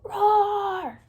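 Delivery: loud, high roar; lion roar. Shown as a demonstration. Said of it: "But if they're not".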